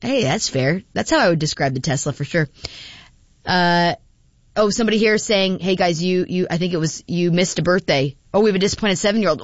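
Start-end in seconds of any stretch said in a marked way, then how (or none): none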